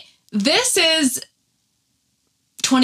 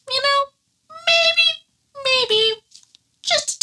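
"You know, maybe, maybe" in a much higher squeaky voice; mock high voice; slower than usual. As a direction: play coy and squeak it up high. Mocking her own realization.

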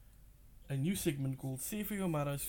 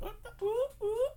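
startled squeal; 4 dB louder than usual. Retold or imitated. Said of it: "They were like".

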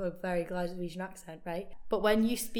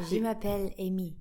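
French accent, slightly louder and amused; put-on French accent; 3 dB louder than usual. Shown as a demonstration. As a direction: giggly attempt at a French accent. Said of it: "put on a French accent".

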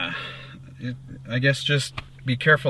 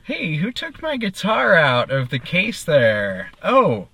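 rising shock, who took my guitar; startled yelling across the room; surprised shout; 6 semitones higher, slower than usual. Acted. Band member finds stowaway in case.

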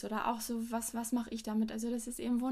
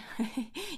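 kurzes Lachen; 4 semitones higher than usual. Real, not acted. Lacht über eigenen Wunsch.

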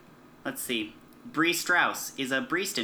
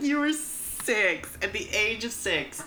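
high, drawn-out disbelief on "six"; mock incredulity; 6 semitones higher, slower than usual. Co-host ribbing a contestant's surgery story, mock incredulous. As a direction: stretch it out high in mock amazement. Mocking a cast member's childhood story.

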